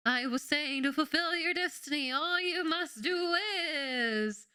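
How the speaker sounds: high, slow dying prophet voice; overacted death scene. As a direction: stretch out each dramatic word. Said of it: "spice stuck in my throat".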